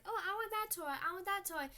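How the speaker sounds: lightly voiced eager girl; greedy little girl. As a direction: beg for the toy like a child. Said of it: "Puff Girls are like".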